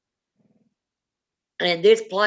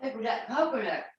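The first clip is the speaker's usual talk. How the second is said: hoarse, softer voice after choking on food; swallowed wrong way; 5 dB quieter than usual. Real, not acted. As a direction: speak through the sting of a choke. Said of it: "it went down the wrong pipe".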